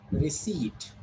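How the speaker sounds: "receipt" as ri-SEET, silent P; receipt with long E. Shown as a demonstration. Teacher confirming the answer, receipt with long E. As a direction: stretch the second vowel of "receipt", ee. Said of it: "This is long E".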